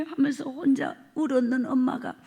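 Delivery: normal talking voice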